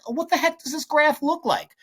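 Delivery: very high-pitched mock student voice; baffled student. Teacher voicing a student lost without a calculator, mock-baffled. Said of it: "And if you're like".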